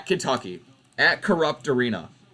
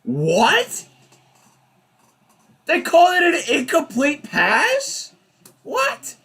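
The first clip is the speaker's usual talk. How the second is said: real outrage, high, louder and disbelieving; outraged disbelief; 6 semitones higher, 3 dB louder, slower than usual. Real, not acted. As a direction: shout it at the screen like the ref robbed you. Streamer outraged at a bad call.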